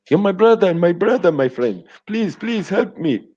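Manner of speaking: very high, wheedling begging voice; high-pitched fake plea to a friend; mock desperate pleading. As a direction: beg for help in a hammed-up squeal. Mocks someone pleading when in trouble.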